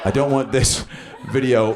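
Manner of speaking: ordinary talk, nothing marked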